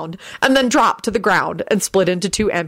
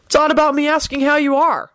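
higher-pitched, irritated retort; irritable; 9 semitones higher than usual. A narrator's reading. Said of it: "Henry said irritably".

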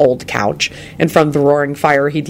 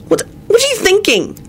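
much higher, pleading and intense; passionate. A narrator's reading. Elizabeth pleading with Lupin about his odd look, passionate and high. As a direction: demand an answer from him with feeling. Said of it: "she said passionately".

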